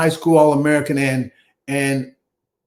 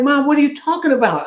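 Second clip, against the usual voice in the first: retold mad protest; 9 semitones higher than usual. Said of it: "I'm, I'm, I'm mad".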